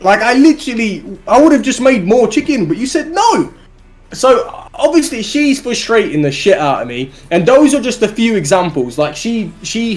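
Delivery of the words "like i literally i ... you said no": high-pitched, exasperated comic rant; indignant outburst. Retold or imitated. Arguing with a date over chicken, high indignant rant. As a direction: protest in squeaky disbelief.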